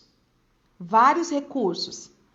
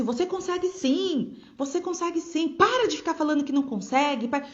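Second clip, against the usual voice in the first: high-pitched, insistent pep talk; mock a teacher nagging a pupil to believe; pushy encouragement; 4 semitones higher than usual. Teacher to a doubting pupil, insistent encouragement. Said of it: "não adianta você ficar falando assim".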